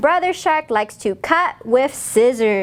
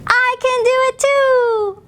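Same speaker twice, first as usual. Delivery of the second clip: put-on high, childish little voice; childlike refrain; 11 semitones higher than usual. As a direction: pipe it like a proud toddler. Child's refrain in read-aloud book.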